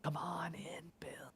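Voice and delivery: eerie, breathy whispered coaxing; hushed, beckoning whisper; whispering alligators. Retold or imitated. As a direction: whisper it like alligators luring their prey. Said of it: "hear the alligators whispering".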